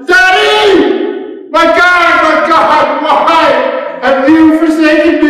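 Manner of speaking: very high, loud anguished wail; grief-stricken shriek. Acted. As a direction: shriek it out like an abandoned son crying to his father. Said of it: "it's a shriek. It's a, a shriek of the deepest grief".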